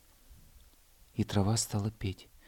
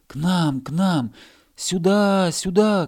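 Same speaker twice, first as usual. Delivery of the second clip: higher, louder calls building; shouting; 8 semitones higher, 7 dB louder, slower than usual. Acted. Grass calling the hare from every side, loud. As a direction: voices from all sides clamouring. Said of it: "кричали со всех сторон".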